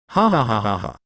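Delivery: a low mocking ha ha ha; mocking laugh. A demon laughing at a fallen dragon, mocking laugh. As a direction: laugh it off with scorn.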